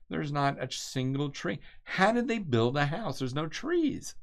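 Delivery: high-pitched, baffled exclamation; real incredulity. Real, not acted. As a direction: pitch leaps up in puzzled disbelief. Incredulous at treeless Icelandic landscape.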